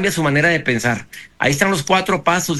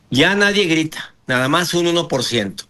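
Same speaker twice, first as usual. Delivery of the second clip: ordinary talk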